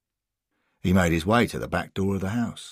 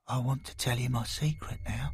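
put-on softer, hushed boy confessing; softly confiding; 5 semitones higher, 7 dB quieter than usual. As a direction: tell it quietly, almost afraid.

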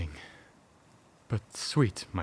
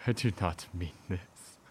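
ordinary talk

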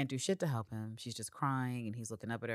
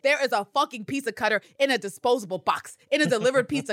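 loud, high, scolding tirade; exasperated scolding; 9 semitones higher, 8 dB louder than usual. Real, not acted. Scolding Constantine about the pizza cutter, exasperated. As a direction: scold him like he should know better.

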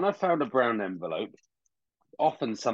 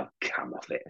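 scoffing dismissal; 13 semitones lower, 7 dB quieter than usual. Retold or imitated. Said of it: "and I was like".

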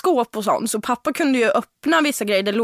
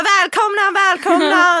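much higher, louder host cry; welcoming shout; 8 semitones higher, 5 dB louder, slower than usual. Retold or imitated. Drunk teen host welcoming all guests, shouting. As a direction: call out to everyone like an overjoyed host. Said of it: "Jag bara".

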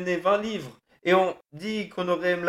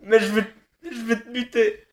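sobbing threats; 3 semitones higher than usual. Acted. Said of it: "Les sanglots saccadaient ses jurons".

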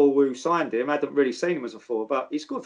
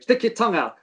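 mock manager order; 7 semitones higher, 3 dB louder than usual. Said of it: "He goes".

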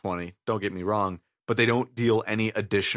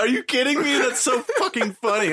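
delighted; 12 semitones higher, 4 dB louder than usual. Real, not acted. Delighted by the pun.

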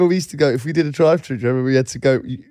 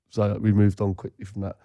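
usual speaking voice

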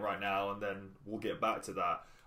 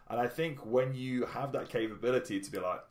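normal talking voice

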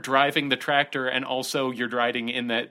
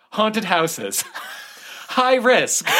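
laughing; 9 semitones higher, much slower than usual. Acted. Reading article, breaking into laughter.

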